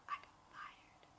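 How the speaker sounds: breathy whisper, almost no voice. Retold or imitated. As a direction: whisper the news to a friend at work. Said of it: "Like, I whispered".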